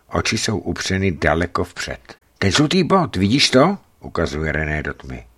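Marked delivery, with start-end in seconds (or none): 2.4-3.8 high, loud, eager pointing-out; excited. Acted. René points out a yellow dot in the dark, excited. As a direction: urge them to look at it.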